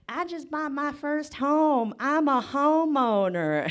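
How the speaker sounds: high, slow, drawn-out brag; smug classmate. Retold or imitated. Comic mocking classmate's Facebook post, high slow smug brag. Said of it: "she was like".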